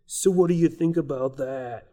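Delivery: put-on first try at a Boston accent, a bit shifted; attempted Boston accent, not quite there. Host tries a Boston accent on the guest. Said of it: "that's my Boston accent".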